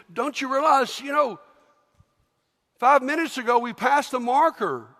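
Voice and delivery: guilty soldier amazed he's still walking; surprised soldier. Acted. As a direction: point it out in disbelief.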